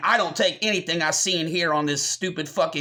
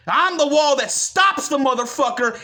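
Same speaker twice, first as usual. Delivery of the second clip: higher and louder, rising to a yell; defiant shout; 7 semitones higher, 4 dB louder than usual. Real, not acted. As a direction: roar it as a challenge. Defiant declaration to viewers.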